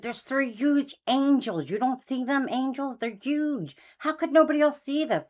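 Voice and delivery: pressing her husband to look at the angels in disbelief; amazed urging. Retold or imitated.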